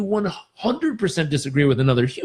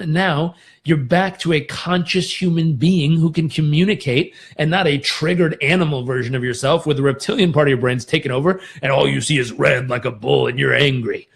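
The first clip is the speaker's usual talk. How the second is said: normal talking voice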